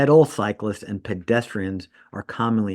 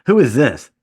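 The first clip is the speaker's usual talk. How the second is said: suspicious demand; 4 semitones higher, 9 dB louder than usual. Retold or imitated. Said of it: "I'm like".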